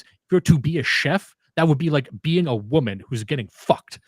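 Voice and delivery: odd accent imitation, T's crisply hit in "to" and "getting"; mocking his T's. Cohost mocks how the poster says his T's. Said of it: "he pronounces T's like this".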